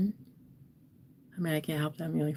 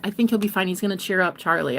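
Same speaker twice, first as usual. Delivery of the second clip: ordinary talk, nothing marked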